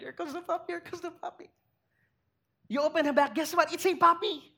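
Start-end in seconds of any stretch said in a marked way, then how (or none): 0.0-1.5 put-on excited child. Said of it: "and you're so excited".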